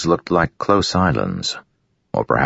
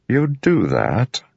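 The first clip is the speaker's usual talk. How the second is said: slow, high, wary question; narrow-eyed doubt; suspicious; 10 semitones higher, much slower than usual. Acted. Royan's eyes narrowed, doubtful.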